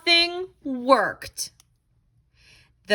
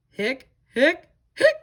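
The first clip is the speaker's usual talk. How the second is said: crying hiccups; 2 semitones higher than usual. Acted. Skeleton hiccups in picture book.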